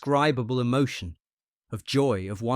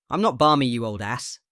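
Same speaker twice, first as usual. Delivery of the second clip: loud, annoyed shout at the friend; 7 dB louder than usual. A narrator's reading. Patient shouts at his old friend, an annoyed shout. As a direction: bark it out, fed up. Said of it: "he shouted".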